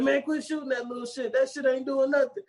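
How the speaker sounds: high, fed-up complaint; mock exasperated gangster. Quoting the movie, high fed-up whine. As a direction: whine at him to stop shooting.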